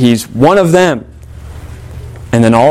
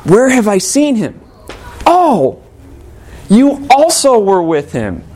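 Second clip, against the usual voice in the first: lightly acted accuser, pitch riding high; higher-pitched, mildly acted pointed recognition; suspicious accuser; 8 semitones higher than usual. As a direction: draw it out high, recognizing someone. Acting the suspicious servant.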